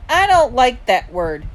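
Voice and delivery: yelled reprimand. A narrator's reading. Mom objects to younger son's word, yelling. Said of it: "Mom yelled".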